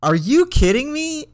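high, loud incredulous cry; disbelief. Retold or imitated. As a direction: squeak it out in shock. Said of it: "And I was like".

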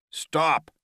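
loud, high plea to stop; desperate shout. A narrator's reading. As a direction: shout while blocking blows. Omori shields his face.

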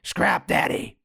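whispered gravelly growl; husky gravelly wrestler voice; gravelly impression. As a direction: rasp it out low, almost breathless. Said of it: "he has more of a gravelly voice".

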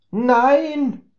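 high, loud, startled "Nein!"; excited shout. Acted. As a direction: burst out in shock. Max shocked realization. (aufgeregter Ausruf)

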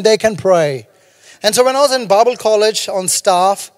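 ordinary delivery

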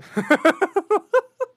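loud, high-pitched laugh; burst of laughter. Real, not acted. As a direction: crack up loudly at the absurd headline. Ri da própria manchete absurda. (gargalhada alta e aguda)

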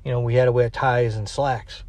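ordinary delivery

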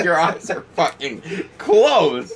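slow, drawn-out retort with a laugh; incredulous retort. Retold or imitated. Friend answering very high Steve, laughing incredulous retort.

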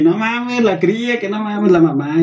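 high-pitched mock lament; imitated crying friend. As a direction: imitate a friend wailing, half laughing by the end. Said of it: "llore, llore".

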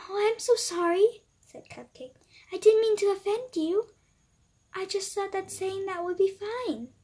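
put-on a little girl caught out; flustered apology. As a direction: Cupcake apologizing for calling the dog fat. Cupcake apologizes to offended dog.

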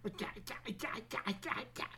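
high, soft clacking sounds; quick high mock clatter; toy teeth chattering noise. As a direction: imitate the wind-up teeth rattling. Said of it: "it goes".

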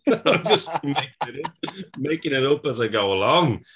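normal talking voice

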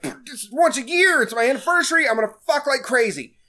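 high, eager mock boast; excited quote. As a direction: hype it up like a horny husband. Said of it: "And he's like".

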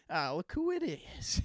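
slow, lightly voiced dad; classic dad greeting. Friend jokes about classic dad approaches, slow mock-dad greeting. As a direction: draw it out like a dad's hello. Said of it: "the classic dad approaches".

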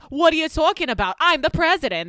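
high, loud mock Jersey accent; New Jersey accent. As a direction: play it as a brash New Jersey caricature. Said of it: "Like that. I believe that's the equivalent".

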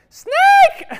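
high, loud scream of alarm; mom yelling snake. Retold or imitated. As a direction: shriek it like a startled mom. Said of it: "and she's like".

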